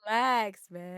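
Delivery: suddenly loud, telling someone to relax. Acted. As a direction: blurt it out loud. Loud outburst after the quote.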